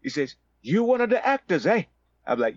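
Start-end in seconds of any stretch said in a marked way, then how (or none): 0.6-1.8 high-pitched South African question; South African accent. Retold or imitated. Stranger recognising an actor, high South African accent. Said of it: "with a South African accent".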